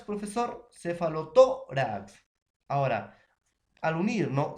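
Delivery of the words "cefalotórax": "cefalotórax" slowed, with "tó" loud and held; stress on TÓ. Shown as a demonstration. Teacher on joining two words, loud long stress on "tó" in "cefalotórax". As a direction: punch and stretch "tó" in "cefalotórax". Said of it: "la primera palabra va a perder su pronunciación".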